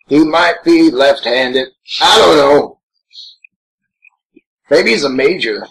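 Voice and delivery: usual speaking voice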